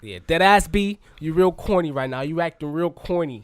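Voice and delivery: put-on loud New York accent; hammed-up New York street accent. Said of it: "That's my New York accent".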